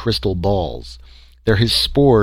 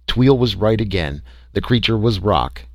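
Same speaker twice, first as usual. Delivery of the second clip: ordinary delivery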